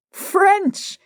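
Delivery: high, scoffing exclamation of "French"; scornful. A narrator's reading. Nepomuk mocking her excuse, high scornful outburst. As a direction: spit out "French" in disbelief.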